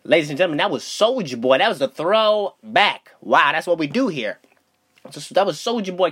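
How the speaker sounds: ordinary talk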